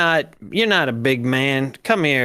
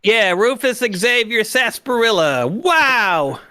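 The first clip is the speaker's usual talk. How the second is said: high excited echo; 7 semitones higher, slower than usual. Acted. Echoing joke name.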